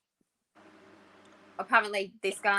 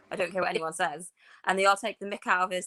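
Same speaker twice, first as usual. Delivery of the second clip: ordinary talk, nothing marked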